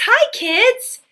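ordinary talk

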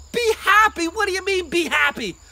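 high, loud disbelief; scandalized questioning; incredulous retort. Acted. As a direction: throw the words back in outrage. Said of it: "And the others said".

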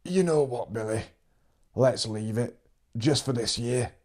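lightly voiced old farmer, teasing; a touch of the old man's cracked voice; old man suggesting, lightly voiced; hint of the old man. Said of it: "and he'd add".

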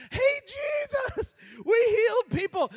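high, loud, excited shout; excited disciples shout. Retold or imitated. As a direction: burst in with the great news. Disciples rushing back excited.

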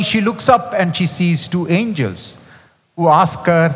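normal talking voice

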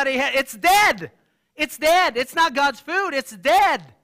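loud, high preaching emphasis; loud emphatic. Real, not acted. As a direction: hammer home that it's dead. Preacher stressing dead food.

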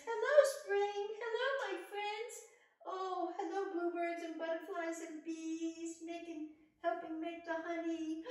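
high, loud, happy little bear voice; cheerful teddy bear. Acted. Teddy bear greeting his spring friends, high and bright. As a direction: greet every friend with delight.

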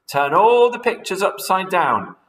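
high, loud urgent instructions; rally the birds with orders; crying out orders. Acted. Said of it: "cried Mugglewump".

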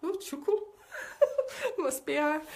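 high-pitched exaggerated echo; mock put-on voice. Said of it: "I don't talk like that".

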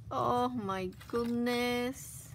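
ordinary delivery

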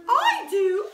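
high, loud queenly cry; queen's voice. A narrator's reading. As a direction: trill it like a grand queen. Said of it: "said the queen".

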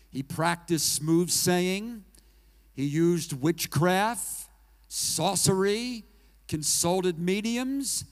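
loud and slow, each sin hammered out; slow, forceful preaching. Acted. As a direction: pound every word of the list from the pulpit. Listing the king's sins.

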